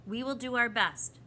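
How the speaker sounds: solemn, firm assurance; grave. A narrator's reading. The proc replies about the bird's care, gravely. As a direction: promise it with weight. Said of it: "the proc replied gravely".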